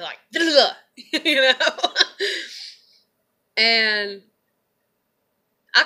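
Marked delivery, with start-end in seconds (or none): 0.3-1.5 loud, high mocking "Duh"; sarcastic duh. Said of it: "So I just like". 1.6-2.7 loud, high cackle; high burst of laughter. Real, not acted. Laughing after mock reply.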